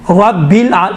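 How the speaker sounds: ordinary talk, nothing marked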